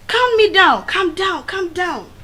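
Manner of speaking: loud, high call that fades; urging her to calm down; urgent calm down. Retold or imitated. Classmates holding her back, loud then fading. Boys restraining her, repeated.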